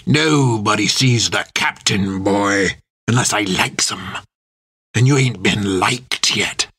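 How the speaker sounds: slow, salty sailor threat; menacing first mate. A narrator's reading. First mate refuses prisoner the captain, slow menacing sailor voice. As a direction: draw it out like a bullying old sailor.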